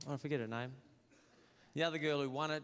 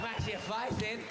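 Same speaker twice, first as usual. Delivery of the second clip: mock yobbo threat; 8 semitones higher, slower than usual. Miss Townsville threatens.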